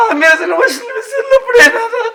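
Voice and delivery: high, tearful wail; sobbing. Acted. Betrayed man revealing the affair to Nana, sobbing. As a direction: break down while revealing the affair. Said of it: "काय येडाबिडा झाला काय".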